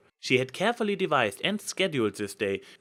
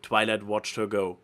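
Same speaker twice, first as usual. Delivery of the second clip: plain reading voice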